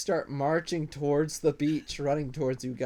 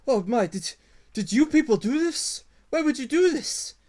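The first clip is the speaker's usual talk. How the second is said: much higher, louder grieving lizard voice; distraught kobold; 13 semitones higher, 7 dB louder than usual. Acted. Kobold accusing the party over dead recruits, high and loud. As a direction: wail it up high at the strangers. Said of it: "and he says".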